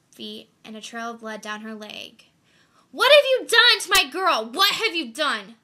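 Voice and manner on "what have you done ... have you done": high, loud, raw screaming; anguished scream. Acted. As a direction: scream it at the attacker. Mother screams at the attacker.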